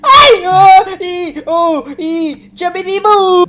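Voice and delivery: high frightened cries; panicked shrieks. Real, not acted. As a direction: shriek as the monster hits. Monstro ataca no jogo. (gritos de pânico)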